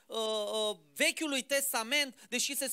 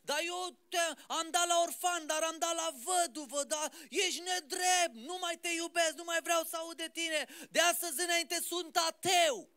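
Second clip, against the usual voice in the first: higher, shouted accusation, dragged out; a man who has lost everything and screams his grievance upward, pitched high; pretend raging at God; 6 semitones higher than usual. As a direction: rail at God like a betrayed believer. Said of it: "n-a urlat, n-a zbierat la Dumnezeu".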